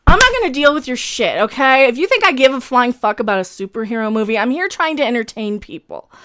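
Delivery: normal talking voice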